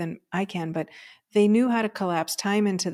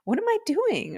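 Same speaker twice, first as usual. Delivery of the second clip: pitched way up in disbelief; incredulous self-question; 12 semitones higher than usual. Retold or imitated. Questioning his own sanity over the relationship, incredulous. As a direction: ask yourself in shocked disbelief. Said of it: "I'm like".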